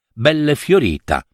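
plain reading voice

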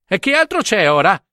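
esclamazione più acuta; grido. Acted. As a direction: grida seccato verso la sorella. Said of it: "gridò". (il prete chiede alla sorella e alla serva spaventate, gridando seccato)

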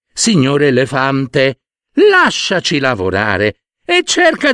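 high, firm then politely pleading; ant voice. Acted. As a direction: set him straight, then ask nicely. Ants reply, then plead. (formiche che chiedono di lavorare in pace)

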